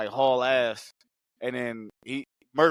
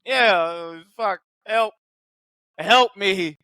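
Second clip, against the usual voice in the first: higher, louder mock cry for help; mock pleading; 5 semitones higher, 6 dB louder, slower than usual. [name] calling for help, mock panic. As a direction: whimper for help, hammed-up.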